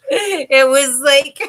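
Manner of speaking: high, loud words broken by real laughter; can barely get it out for laughing; laughing through words. Real, not acted. Recalling the crowded house, laughing helplessly as she tells chat.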